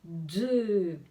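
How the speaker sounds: drawn-out "de" sound of the letter D. Shown as a demonstration. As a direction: sound out "de" on its own, held long. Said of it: "elle fait le son de".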